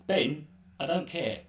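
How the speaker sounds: usual speaking voice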